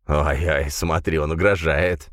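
ordinary delivery

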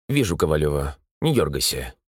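a hint of a stern old surgeon; dry curt old surgeon. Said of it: "звучал, как сухой удар кастаньет".

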